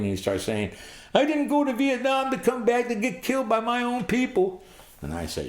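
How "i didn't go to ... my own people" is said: very high mock-hysterical wail; crying. Terrified Vietnam veteran friend hiding in the weeds, high tearful mock wailing. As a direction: wail it like someone breaking down. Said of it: "he starts crying".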